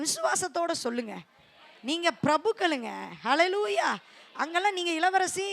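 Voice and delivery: usual speaking voice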